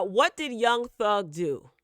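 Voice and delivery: ordinary talk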